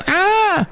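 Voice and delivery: very high mock squeal. Podcaster imitating another Canadian singer, high mock scream. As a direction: imitate a piercingly high rock wail, without much volume. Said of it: "would scream into their microphones".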